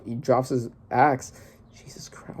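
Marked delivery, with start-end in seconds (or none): 1.8-2.4 breathless whispered disbelief; shocked whisper. Real, not acted. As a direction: whisper it, stunned by what you see. Shocked reaction to manga panel.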